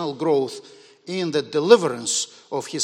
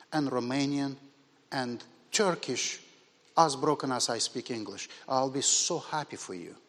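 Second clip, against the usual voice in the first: calm; 5 semitones lower, 5 dB quieter than usual. Retold or imitated. Retelling his answer to the upset client, calm. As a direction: answer calmly and softly, phrase by phrase. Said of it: "calmly, I said".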